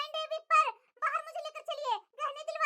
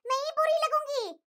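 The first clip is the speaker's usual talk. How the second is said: normal talking voice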